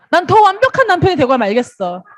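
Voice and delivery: a hint of a fiercely determined husband.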